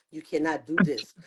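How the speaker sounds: ordinary talk, nothing marked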